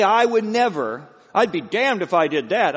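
loud, high mock indignation; self-righteous vow. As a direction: declare it with mimicked moral certainty. Mimicking self-righteous moral vow.

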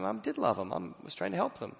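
ordinary talk

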